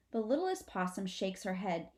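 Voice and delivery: ordinary delivery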